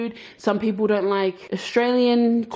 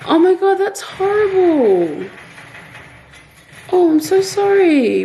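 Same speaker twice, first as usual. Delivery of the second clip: shocked sympathy; 8 semitones higher than usual. Real, not acted. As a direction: sound shocked and sorry for the viewer. Reacting to a sad comment.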